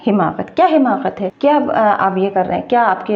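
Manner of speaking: ordinary talk, nothing marked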